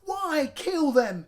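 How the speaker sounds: high, loud and slow wail; howling plea. A narrator's reading. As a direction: drag out the howl. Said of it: "howled Henry".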